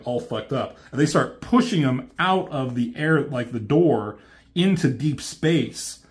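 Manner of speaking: usual speaking voice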